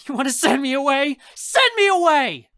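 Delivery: high and loud, climbing to a scream; angry challenge building to yell. Acted. Raz dares uncle to evict, escalating furious shout.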